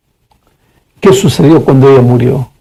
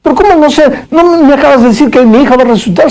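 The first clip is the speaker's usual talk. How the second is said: incredulous father; 10 semitones higher, faster than usual. Acted. Father presses girl, incredulous.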